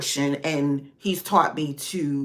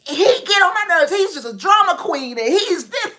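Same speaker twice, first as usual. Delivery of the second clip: high, loud fed-up rant; mock yelling; 12 semitones higher, 3 dB louder than usual. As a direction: complain dramatically about a coworker. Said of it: "And I'm in here yelling".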